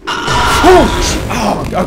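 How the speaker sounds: sudden high yelp of fright; startled shout. Real, not acted. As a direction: jump at a sudden scare. Sudden scare in horror game.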